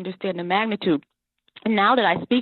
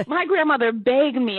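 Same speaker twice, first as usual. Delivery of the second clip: ordinary delivery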